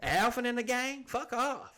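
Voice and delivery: high-pitched comic indignant rant; mock outrage. Homeowner refusing to lose his house to rodents, outraged. Acted defiance of invading rodents.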